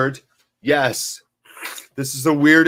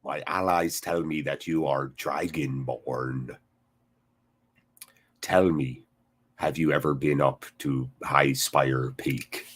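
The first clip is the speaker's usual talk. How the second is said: much lower, softer, slow regal rumble; Ulfric impression; 10 semitones lower, 5 dB quieter than usual. Streamer continuing his Ulfric voice for chat, low and slow. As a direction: speak as a grave Nord warlord. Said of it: "That's my Ulfric".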